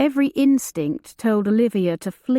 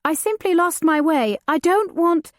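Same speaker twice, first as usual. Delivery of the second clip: frightened pleading; 8 semitones higher than usual. Acted. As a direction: plead quickly, afraid.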